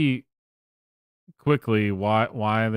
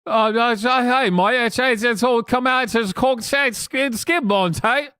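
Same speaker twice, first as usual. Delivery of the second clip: high-pitched, louder mock Cockney babble; fake Cockney accent; 12 semitones higher, 4 dB louder than usual. Streamer mocking a British chatter in a fake Cockney accent. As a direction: ham up a rough London street accent.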